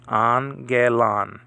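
slow "Angelon" in three clear syllables, an-ge-lon; syllable drill. Shown as a demonstration. Teacher drilling the word slowly for students. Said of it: "Three syllables here".

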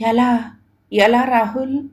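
understated tearful plea. A narrator's reading. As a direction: ask helplessly, on the verge of tears. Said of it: "అంది ఏడుపు గొంతుతో".

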